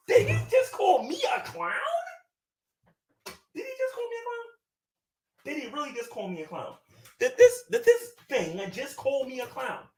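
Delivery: high, hammed-up indignant squeals; theatrical shock, pitch flying up with half-laughs; mock outrage. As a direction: play the offended diva over the top. Mock outrage at being called clown.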